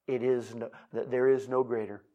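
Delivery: ordinary talk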